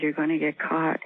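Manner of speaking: normal talking voice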